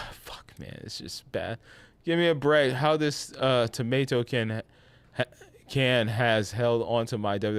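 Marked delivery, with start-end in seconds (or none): 0.1-1.6 breathy, much softer, deflated; real defeated whisper. Real, not acted. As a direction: let it out after a sigh. Defeated sigh.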